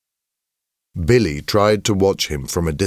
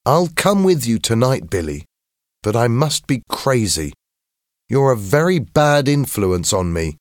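higher-voiced boy, mildly complaining; reluctant schoolboy; 6 semitones higher than usual. A narrator's reading. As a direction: give in to a friend with mild reluctance. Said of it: "he didn't sound happy".

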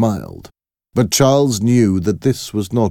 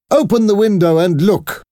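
shouting; 4 dB louder than usual. Acted. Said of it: "the Marquis shouted".

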